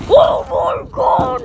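slow, loud, high exclamation; hearing the answer, shouting excitedly. Real, not acted. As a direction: burst out in excitement.

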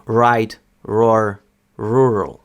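right, roar, rural with a strong r throughout; American-accented right, roar, rural; stronger American R. Shown as a demonstration. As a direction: hit every r in right, roar, rural, including the last. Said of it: "американці трішки сильніше".

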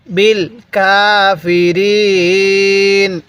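"কাফিরিন" with the last vowel held very long; elongated recitation. Shown as a demonstration. Teacher showing how to read, long drawn-out recitation. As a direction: stretch কাফিরিন slowly before closing on n. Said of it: "এরকমভাবে শিখব".

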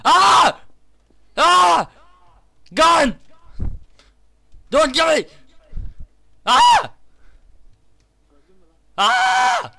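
screaming in panic; being chased. Real, not acted.

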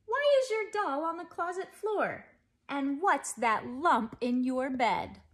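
high, loud exasperated mother; mother confronting her daughter, loud and high; mother scolding. A narrator's reading. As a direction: demand answers like a shocked mother. Mother scolding about messy room.

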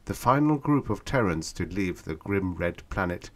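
ordinary delivery

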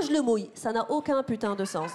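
ordinary talk, nothing marked

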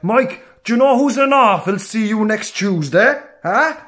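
put-on high, slow, questioning old man; oblivious granddad. Granddad asking grandson about a neighbour, slow and high. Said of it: "He's like".

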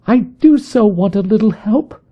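frightened visitor crying out in a high voice. A narrator's reading. Distressed visitor pleads.

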